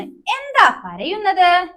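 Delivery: teacher voicing the firefly for children, slow and high. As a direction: stretch the question out like a caring little firefly. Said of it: "മിന്നാമിനുങ്ങ്".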